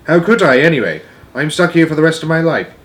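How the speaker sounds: lightly voiced child arguing back; protesting Sophie. Sophie protests she is stuck in the cave, indignant.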